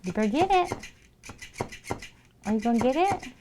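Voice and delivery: high, sing-song voice for the pets; pet talk. Real, not acted. Streamer greeting her pets in a high baby-talk voice. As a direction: coo at the animals like babies. Urging the cat to play.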